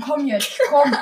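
ordinary talk, nothing marked

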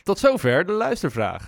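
high, loud jingle-style echo; loud announcer voice. Acted. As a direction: belt it out like a radio jingle. Echoing louder as jingle.

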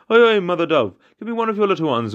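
high storyteller's holler, loud at first; crying out. A narrator's reading. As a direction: call out high and demanding. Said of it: "he cried out for a second time".

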